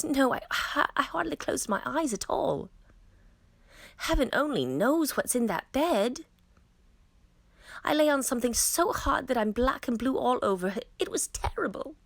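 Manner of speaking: a delicate princess complaining of a sleepless night; exclaiming princess. A narrator's reading. The princess tells the queen how badly she slept, high and aggrieved. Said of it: "exclaimed the princess".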